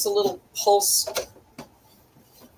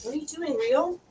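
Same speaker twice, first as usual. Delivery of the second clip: high, sweet voice for the pet; pet talk; 9 semitones higher than usual. Real, not acted. Speaking to her pet Rio, high coaxing pet voice. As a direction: coo at the pet Rio, pitched up.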